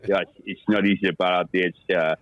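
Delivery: low South African cricketer voice; South African impression. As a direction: talk like a South African batsman. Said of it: "Jonathan Trove, I'm talking like Trove is".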